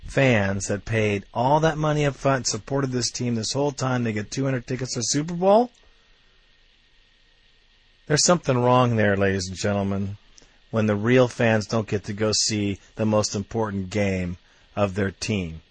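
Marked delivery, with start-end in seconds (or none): none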